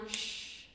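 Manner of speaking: long unvoiced shh; shushing. Shown as a demonstration. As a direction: shush out the breath. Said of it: "this time".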